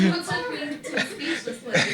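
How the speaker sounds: high, slow laughing words. Real, not acted. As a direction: speak through big laughter. Laughing in disbelief.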